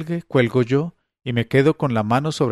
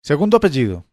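louder, sharp demand; cross-examining a suspect, curt and loud; like a prosecutor; 3 semitones higher, 5 dB louder, faster than usual. Acted. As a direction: fire it at her like a prosecutor. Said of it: "le espeto como un fiscal".

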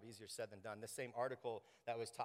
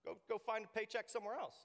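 high, dismissive "go get a job"; a hint of a smug bystander; lightly mocking glib adviser; 9 semitones higher than usual. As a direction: brush the problem off, lightly mocking. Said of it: "people might just be like".

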